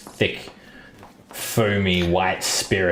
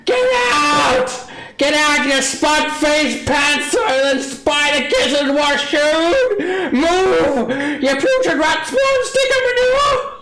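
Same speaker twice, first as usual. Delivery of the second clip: shrieked insults, much higher and louder; screaming innkeeper; 5 dB louder, slower than usual. Acted. Innkeeper Hannah throws the singing bard out, screaming. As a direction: scream them out of the tavern. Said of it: "Hannah just yells at you".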